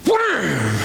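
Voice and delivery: shouted comic "wham" sound effect; mock punch sound effect. Preacher acting out punching the listener, a comic wham sound. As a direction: bark out the punch noise like a cartoon.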